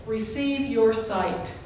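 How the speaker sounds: slow, even, unhurried command; simple quiet command. Shown as a demonstration. Jesus healing the blind man, slow and simple. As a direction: say it simply and slowly. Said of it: "he simply said this".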